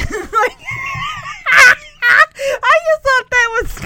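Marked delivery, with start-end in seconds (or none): 0.6-2.6 high, loud cackling laugh; really cracking up; burst of laughter. Real, not acted. Amused by her own retelling, laughing out loud.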